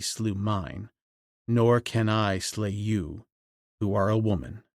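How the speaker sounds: low, soft, measured speech; a hint of the grave ape man, low and soft; lightly voiced ape man. A narrator's reading.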